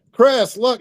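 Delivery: high urgent "Chris, look"; urgent excited call. Retold or imitated. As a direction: grab his attention, pitched high.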